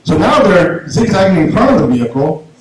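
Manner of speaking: usual speaking voice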